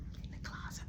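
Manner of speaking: hurried whispered order; whispered command to hide; urgent whisper. Retold or imitated. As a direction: hiss it so no one hears.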